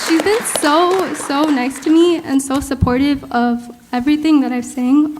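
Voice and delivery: choked up, fighting back tears between laughs; tearing up. Real, not acted. Student thanking her faraway teacher, tearfully choked up. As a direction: voice catching with real tears while giving thanks. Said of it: "Sorry, I don't know what I'm crying".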